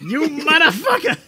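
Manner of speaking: high mobster squawk, laughing; Joe Pesci. Acted. Yakuza talking like a movie mobster, high mock threat. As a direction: curse in comic gangster voice. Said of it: "sound like Joe Pesci".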